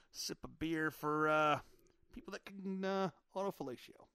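normal talking voice

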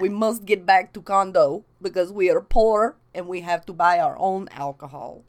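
half-assed Russian with loud stresses; stilted comic Russian English; mock Russian accent. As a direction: ham up a bad Russian accent. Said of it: "your Russian accent".

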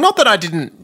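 normal talking voice

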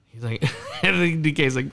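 ordinary talk

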